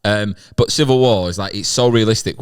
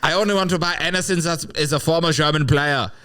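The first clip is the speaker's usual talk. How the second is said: put-on higher, lightly German-accented demand; German paratrooper after only German player memorabilia; mock German collector; German soldier; 7 semitones higher than usual.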